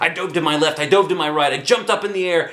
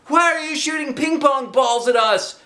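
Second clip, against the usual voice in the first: shouted. A narrator's reading. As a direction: protest to the teacher. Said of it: "shouted Andrea".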